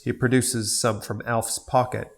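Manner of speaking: ordinary talk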